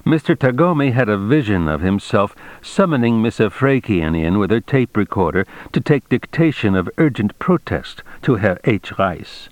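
usual speaking voice

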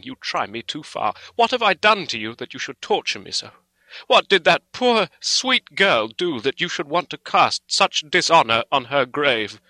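loud, high, indignant outburst; storm of passion. A narrator's reading. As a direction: protest with impassioned outrage. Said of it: "cried Arthur in a storm of passion".

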